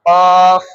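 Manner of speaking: কফ said loud from the back of the tongue, thick and heavy; heavy qaf. Shown as a demonstration. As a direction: push কফ from the root of the tongue, full and thick. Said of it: "মোটা করে আদায় করতে হবে". (জিহ্বার গোড়া থেকে মোটা করে কফ)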